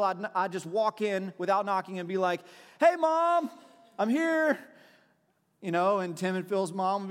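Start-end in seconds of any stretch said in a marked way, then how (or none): 2.8-4.6 high, loud cheerful call across the house. Retold or imitated. Walking into a friend's house, calling out loudly to the mom. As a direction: sing out to the house as you walk in.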